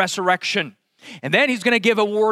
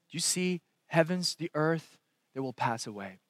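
much softer, almost confiding; hushed; 3 semitones lower, 10 dB quieter than usual. Acted. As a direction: draw them in quietly. Hushed paraphrase of Jesus.

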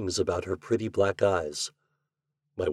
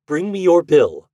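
sharp, loud, higher demand; curt order; 8 semitones higher, 9 dB louder than usual. A narrator's reading. Breed cutting the landlord short, curt loud demand. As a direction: snap out the demand for the bill.

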